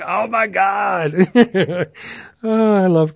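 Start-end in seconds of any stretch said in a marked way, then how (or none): 0.0-1.1 high, drawn-out imitated swoon; mock swoon. Retelling a woman's reaction to Conan, mock swooning. 1.2-1.8 high-pitched amused laugh. Real, not acted. Host cracking up over Conan story, high laugh.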